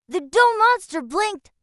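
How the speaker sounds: ordinary delivery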